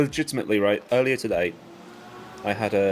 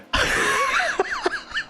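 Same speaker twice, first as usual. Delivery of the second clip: high squealing laugh. Real, not acted. Laughs after second meow.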